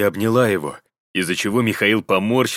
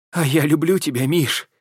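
plaintive whisper; 8 semitones higher than usual. A narrator's reading. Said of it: "жалобно прошептала Таня".

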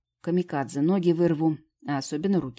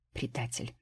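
softer, lower sulky reproach; hurt; 5 semitones lower, 8 dB quieter than usual. A narrator's reading. Fairy calling Vitalik a traitor, softly hurt. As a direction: say it wounded. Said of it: "обиженно сказала фея".

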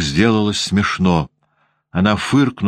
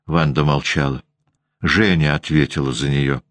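usual speaking voice

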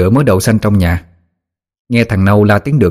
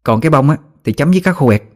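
lightly voiced girl, higher pitch; chatty girl Quyên; 5 semitones higher than usual. Girl invites her friend to pick hyacinth, chatty and higher.

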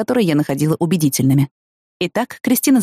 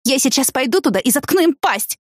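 a hint of a shouted threat; mild mock shout; 7 semitones higher than usual. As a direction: threaten in a raised, furious pitch. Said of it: "крикнула Кристина".

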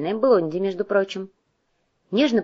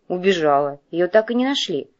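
ordinary delivery, nothing marked

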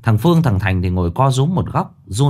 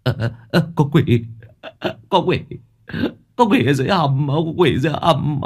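panicked cry for help; 5 semitones higher than usual. Acted. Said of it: "nó rú lên cầu cứu".

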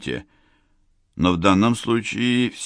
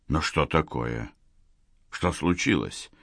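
softer, lower bewildered mumble; stunned mumble; 3 semitones lower, 7 dB quieter than usual. Acted. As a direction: mumble it, dumbfounded. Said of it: "бормотал ошеломлённый полковник".

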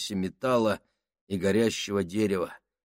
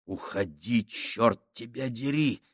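inner thought; 6 dB quieter than usual. A narrator's reading. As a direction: think it to yourself, hushed. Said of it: "пронеслась мысль".

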